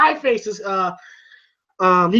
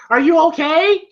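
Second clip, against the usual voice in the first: higher-pitched imitated wife; mock concern; 6 semitones higher, 3 dB louder than usual. Adrian checking on Rocky after fight, mock concern. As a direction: put on a worried partner's question.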